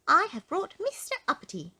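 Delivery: high, loud goblin report. A narrator's reading. Goblin reporting to the sentry, in a high voice. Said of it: "said the goblin".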